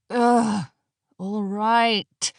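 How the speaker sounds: reluctant sneer. A narrator's reading. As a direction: give in with contempt. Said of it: "she sneered".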